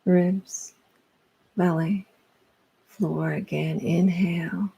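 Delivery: calm breath-led cueing with pauses; guided meditation. Real, not acted. Yoga instructor guiding breath down through the body, calm and spacious. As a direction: cue each part of the breath, unhurried.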